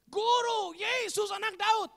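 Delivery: loud, high cry for help; acted shouting. Blind beggar calling Jesus as he passes, high shout. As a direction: shout to be heard over a crowd. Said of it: "teriak-teriak".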